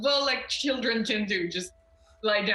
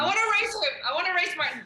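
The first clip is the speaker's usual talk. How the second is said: put-on higher, childlike eager pleading; excited eagerness; 6 semitones higher, much faster than usual. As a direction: pipe up, keen to race. Character eager to race upstairs.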